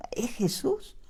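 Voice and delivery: whispered, breathless realization; awed whisper. Retold or imitated. Blind man realizing Jesus is passing, whispered. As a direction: breathe it like a secret you just grasped.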